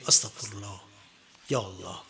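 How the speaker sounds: pleading, tearful prayer to God; repentant prayer. Shown as a demonstration. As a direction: beg forgiveness, voice near tears.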